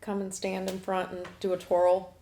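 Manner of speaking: ordinary delivery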